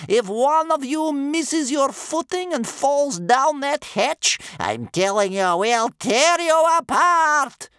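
screamed high, drawn-out threat; wolf-like howling. A narrator's reading. Grandmother threatens Vikings through the hatch, howling. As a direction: howl it up at the ceiling like a wolf. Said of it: "howling up at the ceiling like a wolf".

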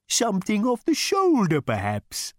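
sudden high, helpful cow voice; suggesting cow. A narrator's reading. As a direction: tempt the diner like a cow waiter. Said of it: "suggested the animal".

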